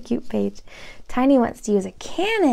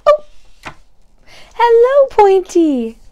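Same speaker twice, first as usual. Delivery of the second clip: much louder, higher delighted cry; loud delighted hello; joyful greeting; 11 semitones higher, 12 dB louder, slower than usual. Acted. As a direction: shout hello with joy.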